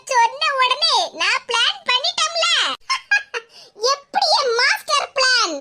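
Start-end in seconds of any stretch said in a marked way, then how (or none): none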